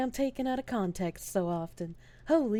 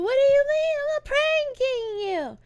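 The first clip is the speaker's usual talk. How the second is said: mock innocent; 8 dB louder than usual. Denies prank in squeaky voice.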